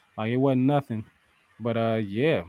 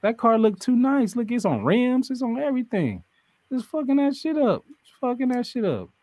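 excited; 12 semitones higher than usual. Retold or imitated. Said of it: "I'm like".

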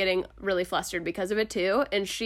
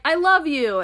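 blurted confession; 7 semitones higher, 7 dB louder than usual. Retold or imitated. Blurted love confession on phone.

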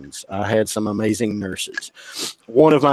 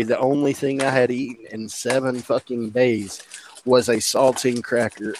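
normal talking voice

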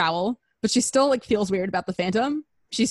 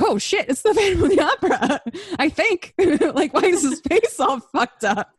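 high-pitched quote broken by laughs; laughing audience; 8 semitones higher, 3 dB louder than usual. Retold or imitated. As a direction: laugh your way through the shocked crowd line. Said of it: "And the audience is like".

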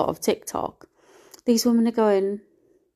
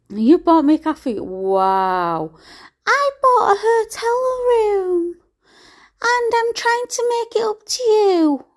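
much higher, slower sneering impression; mocking ex's voice; 13 semitones higher, 3 dB louder, slower than usual. Mocking her ex's hotel-room excuse with a high sneering voice. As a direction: parody him as smug and silly.

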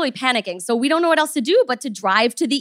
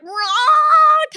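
high, slurred mock dog bark; drunken bark; 13 semitones higher, 4 dB louder than usual. Imitating the sick dog's slurred bark, a high shout. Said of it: "kind of bark thing".

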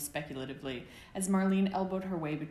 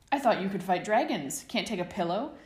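louder, higher mocking taunt; 4 semitones higher, 7 dB louder than usual. A narrator's reading. James teasing Sirius about fighting dragons, loud taunting jeer. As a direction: goad him with a playful jeer. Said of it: "James taunted".

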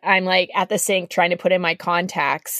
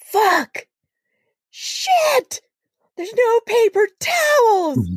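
angry yelling; much slower than usual. Retold or imitated. Drunk guy in the bathroom, raging at no paper towels. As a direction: yell like a drunk guy losing it.

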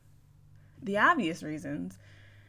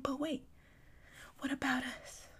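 softer, breathy mock-pleading whisper; whiny whisper; 7 semitones higher, 8 dB quieter than usual. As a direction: beg him back in a pathetic hush. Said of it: "that's how I'm gonna say it".

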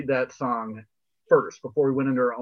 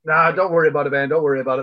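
loud, raised brush-off; mock yelling; 3 semitones higher, 7 dB louder, faster than usual. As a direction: playfully yell it across at your friend. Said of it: "Please don't yell at me".

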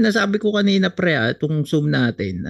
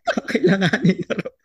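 laughing while repeating; 6 semitones higher than usual. Real, not acted. Laughing over losing the Zoom account.

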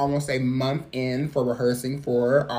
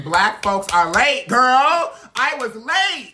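sassy; 7 semitones higher, 6 dB louder, slower than usual. Real, not acted. Sassy confession.

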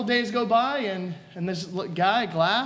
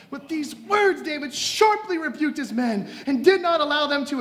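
raised, forceful preacher's reading; impassioned Bible reading, pitched up; dramatic scripture reading; 4 semitones higher, 3 dB louder than usual. Acted. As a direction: declaim it to the congregation with ringing emphasis. Preacher reads scripture aloud.

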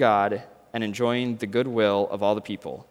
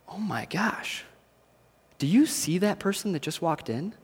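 hushed aside; 4 semitones higher, 8 dB quieter than usual. Acted. Friend whispering in coffee shop.

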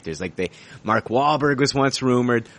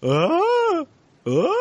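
confused grunt; much slower than usual. Retold or imitated. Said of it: "they're like".

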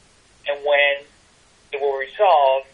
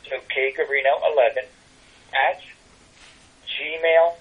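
slow, one letter at a time with pauses; spelling slowly. Real, not acted. Guest gives listeners the tarot email address, spelling slowly. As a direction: dictate it piece by piece, slowly. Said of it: "say it slowly".

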